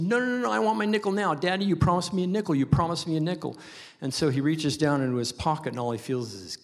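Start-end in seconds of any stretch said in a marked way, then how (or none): 0.0-3.6 put-on high, fast insistent kid voice; whiny little girl. Preacher as girl demanding her nickel from dad. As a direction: nag and whine like a small child.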